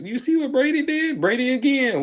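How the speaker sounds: high-pitched hyped-up fan voice; excited fans. Retold or imitated. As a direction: gush like an amazed fan, high and thin. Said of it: "and everybody was".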